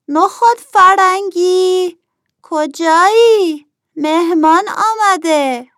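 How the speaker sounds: high-pitched, drawn-out shout; calling out loudly. A narrator's reading. Nokhodi calling for the absent host that a guest has come, loud shout. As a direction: call loudly for someone who's out of sight. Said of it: "نخودی داد زد".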